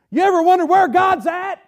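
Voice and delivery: preaching shout, high and loud. Acted. Pastor shouting the question at his congregation, loud and high. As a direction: cry it out to the whole church.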